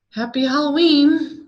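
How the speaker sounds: defiant and afraid. A narrator's reading. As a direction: throw it at the ghost to hide her fear. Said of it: "with a mixture of defiance and fear".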